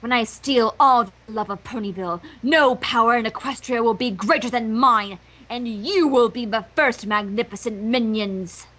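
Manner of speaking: gloating. Acted. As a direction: savour the evil plan. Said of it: "she gloated".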